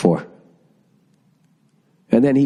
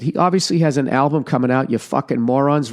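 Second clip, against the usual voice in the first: ordinary talk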